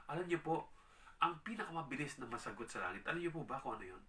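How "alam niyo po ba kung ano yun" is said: ordinary delivery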